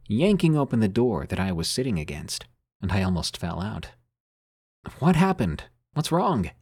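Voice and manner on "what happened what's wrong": higher-pitched, urgent questions; alarmed questioning. A narrator's reading. Narrator asks the brother fleeing the house, alarmed. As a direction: read as a mildly panicked demand for answers.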